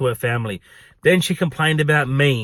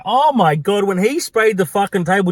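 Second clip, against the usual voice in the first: usual speaking voice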